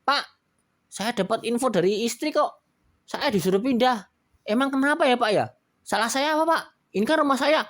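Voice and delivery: high, loud complaint; Pak Juli protesting. Acted. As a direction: rush out the complaint. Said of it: "Pak Juli langsung ngomong". (Pak Juli protes dengan suara tinggi)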